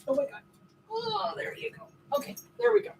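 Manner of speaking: very high relieved praise; delighted squeal. Real, not acted. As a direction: squeal with joy at the dog. Dog makes the jump.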